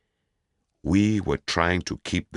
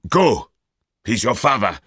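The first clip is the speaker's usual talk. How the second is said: much louder, higher, furious push; angry order; 8 semitones higher, 8 dB louder than usual. Acted. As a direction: snap at her to go. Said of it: "I felt suddenly angry".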